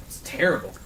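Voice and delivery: ordinary talk, nothing marked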